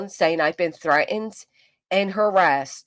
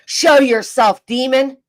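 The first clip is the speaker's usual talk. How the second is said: louder, commanding call to the demon; mock exorcist command; 5 semitones higher, 7 dB louder, slower than usual. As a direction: boom it out like an exorcist.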